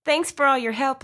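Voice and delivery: a light Texas lilt, grateful; southern accent. Acted. Reese thanking the officer Lloyd, in a light Southern accent. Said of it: "the sound of a woman's southern accent".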